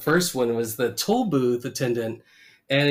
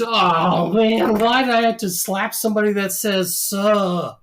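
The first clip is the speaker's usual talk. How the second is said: higher, louder whining Homer; whiny Homer; 7 semitones higher, 4 dB louder than usual. Retold or imitated. Host voicing Homer's regret about the slap, higher whine. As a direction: moan it like a panicked Homer.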